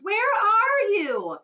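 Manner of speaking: very high, loud, slow wail; mother crying for her lost son; anguished wail. Acted. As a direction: draw it out in anguish. Mother searching for vanished son.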